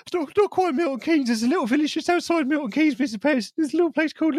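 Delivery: put-on high-pitched, protesting teenage boy voice; Kevin the Teenager. Boy corrects where he's from, mock teenage protest. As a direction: correct them like an embarrassed teen, pitched right up. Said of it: "Kevin the Teenager there".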